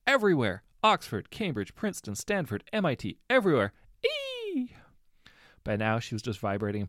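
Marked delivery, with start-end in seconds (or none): 0.1-3.7 high, loud, bursting with joy; overjoyed. A narrator's reading. Girl listing universities in joy. 4.0-4.7 very high breathless squeal of delight; joyful squeal. A narrator's reading. Girl squealing with joy.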